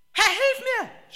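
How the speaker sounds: very high, loud, slow cry for help; desperate scream. Acted. Sinking Peter screaming to Jesus, desperate high cry. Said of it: "schrie er".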